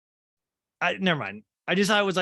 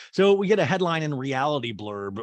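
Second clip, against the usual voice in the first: ordinary delivery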